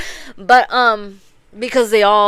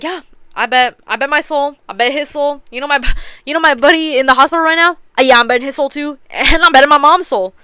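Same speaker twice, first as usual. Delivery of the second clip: higher, louder mock boasting; acted bravado; 5 semitones higher, 5 dB louder, faster than usual. Gambler raising the stakes with souls, acted bravado. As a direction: pile up the stakes, building louder. Acting out an escalating reckless bet.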